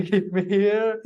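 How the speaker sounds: laughing slowly through the words; a sheepish laugh as he admits it. Real, not acted. Laughs on being kept abroad.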